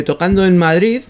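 normal talking voice

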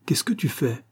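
ordinary delivery, nothing marked

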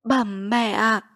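long, slow call to an unresponsive mother. A narrator's reading. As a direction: draw each word out, calling her back. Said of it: "rồi gọi". (gọi mẹ, kéo dài từng tiếng)

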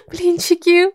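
high gleeful cry; joyful exclamation. A narrator's reading. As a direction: cheer at hearing food. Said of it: "радостно воскликнув".

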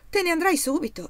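laughing reply. Acted. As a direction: laugh it off, master to servant. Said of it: "rispose il padrone ridendo". (risposta divertita, con una risata)

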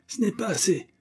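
cried. A narrator's reading. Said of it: "cria celui-ci".

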